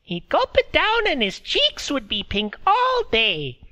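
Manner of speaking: put-on high, thin old woman's voice; old woman speaking through a laugh; old Meredy. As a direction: voice her as a frail old village woman. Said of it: "She laughed again, remembering".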